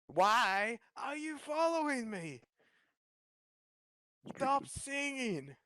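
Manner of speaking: high, slow, stretched mock ogre; annoyed Shrek. Shrek snapping at Donkey, high slow mock ogre. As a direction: drag the words out, fed up.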